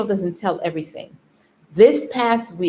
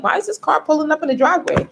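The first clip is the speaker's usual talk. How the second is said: quick, high-pitched puzzled question; mock bewilderment, pitched up; 9 semitones higher, faster than usual. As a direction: imitate a baffled onlooker, rushed and high. Acted caretaker's puzzled look at car.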